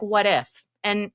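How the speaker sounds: ordinary talk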